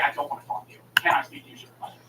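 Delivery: low, hushed, controlled request; restrained refusal. Retold or imitated. Caller wanting a supervisor, quietly restrained. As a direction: hold back the temper. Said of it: "I'm not mad at you".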